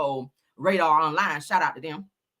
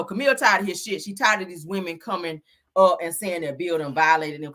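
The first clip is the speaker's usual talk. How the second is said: ordinary delivery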